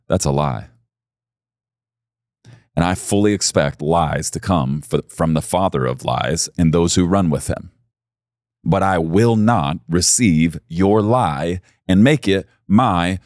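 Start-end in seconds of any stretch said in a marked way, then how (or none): none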